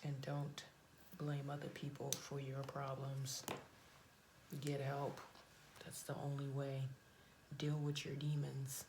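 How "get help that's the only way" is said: usual speaking voice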